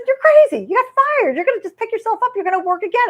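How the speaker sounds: high, loud, laughing impression of her husband. Husband laughing off her firing, high and loud. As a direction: play the amused husband, half-laughing at her. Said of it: "he said".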